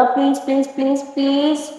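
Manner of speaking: soft, coaxing repeated please; pleading softly. Acted. Girl begging the aunt not to scold, soft pleading. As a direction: wheedle gently, voice dropped.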